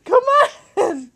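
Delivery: ordinary talk, nothing marked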